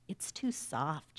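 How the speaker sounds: soft, slow sigh of doubt; quiet, sighed objection. A narrator's reading. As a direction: let it out slowly on a sigh. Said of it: "she sighed".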